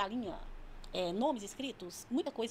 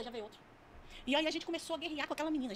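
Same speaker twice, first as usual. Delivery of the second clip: ordinary talk, nothing marked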